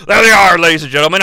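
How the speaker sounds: normal talking voice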